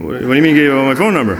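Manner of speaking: fast, high, loud disbelief; incredulous. Retold or imitated. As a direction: demand an explanation in mock alarm. Acted alarmed reply to caller.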